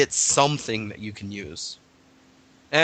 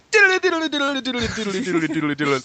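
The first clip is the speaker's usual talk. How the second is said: vocal music imitation; 9 semitones higher than usual.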